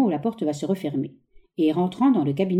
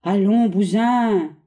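slow, louder persuading; coaxing; 4 dB louder, much slower than usual. Acted. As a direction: draw him along slowly. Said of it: "essayant de persuader Bousin".

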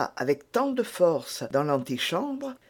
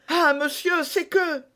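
flustered servant excuse; 12 semitones higher, 3 dB louder than usual. A narrator's reading. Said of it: "vous vous permettez de parler".

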